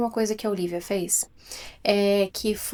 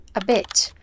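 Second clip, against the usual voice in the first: ordinary talk, nothing marked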